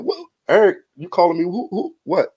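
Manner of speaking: a half-acted what-are-you-calling-me; surprised. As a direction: friend calls out of the blue, surprised. Retelling his surprised phone reaction.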